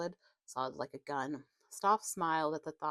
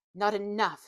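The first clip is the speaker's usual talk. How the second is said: a bit louder, a light narrated shout; mild shout; 4 semitones higher, 6 dB louder than usual. A narrator's reading. Stoff shouts back at the woman he is beating, a mild narrated yell. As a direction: throw it back, only half-raised. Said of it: "he shouted back".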